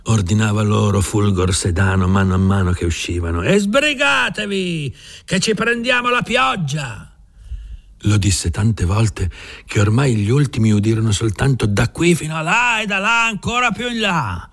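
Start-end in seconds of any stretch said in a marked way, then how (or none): 3.4-7.1 high, drawn-out call to the workers; urgent shout. A narrator's reading. Foreman urging men out. 11.7-14.5 high calling shout; repeated shouted orders. A narrator's reading. Orders heard from afar.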